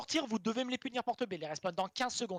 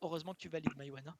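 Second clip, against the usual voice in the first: lost voice; 6 semitones lower, 8 dB quieter than usual. Real, not acted. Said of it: "J'ai quasiment perdu ma voix".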